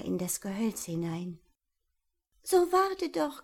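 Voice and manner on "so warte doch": high owl calling out; calling after. Acted. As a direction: call after the fleeing mouse. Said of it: "rief ihm die Eule nach".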